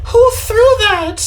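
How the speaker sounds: high, slow teacher's outraged question; teacher demanding who threw it, high and slow; startled teacher. Retold or imitated. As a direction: play the hit teacher, shrill with shock. Teacher hit by paper ball.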